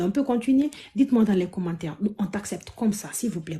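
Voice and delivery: ordinary talk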